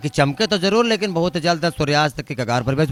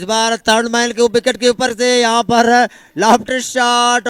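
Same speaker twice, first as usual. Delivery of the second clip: excited cricket commentary; 5 semitones higher than usual. Real, not acted. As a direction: lift the voice as the ball flies. Live shot call rising.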